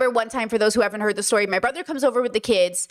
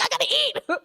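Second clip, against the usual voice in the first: high pitched, laughing through it; laughing desperate plea; 14 semitones higher than usual. Retold or imitated. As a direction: whine it out between laughs like the starving brother. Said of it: "He's like".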